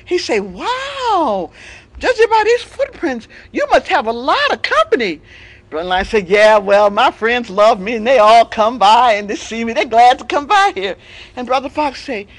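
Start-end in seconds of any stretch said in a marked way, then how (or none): 0.4-5.2 high, loud friendly reply; friendly fox. Acted. Fox notices the footprints. 6.3-11.0 loud, slowed boast; blustering lion. Acted. Lion defends himself.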